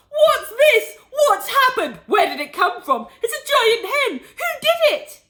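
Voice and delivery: a man beside himself with excitement; frantic excitement. A narrator's reading. Excited father sees giant hen.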